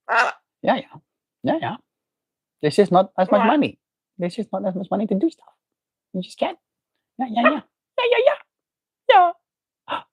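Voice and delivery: high cooing pet voice. Real, not acted. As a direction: coo at the bird, way up high.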